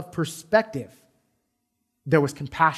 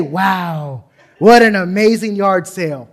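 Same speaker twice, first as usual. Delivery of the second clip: mock amazement; 8 dB louder, slower than usual. Mocking someone admiring a sign.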